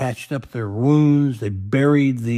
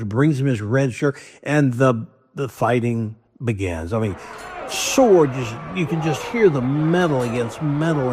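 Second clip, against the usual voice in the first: normal talking voice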